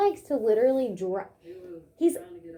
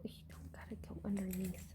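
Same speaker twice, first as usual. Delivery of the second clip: hushed reassurance; whispering; 4 semitones lower, 19 dB quieter than usual. Real, not acted. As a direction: whisper it gently to the dog. Owner whispers to dog.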